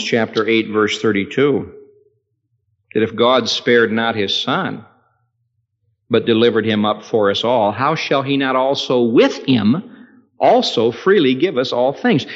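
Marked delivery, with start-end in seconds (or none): none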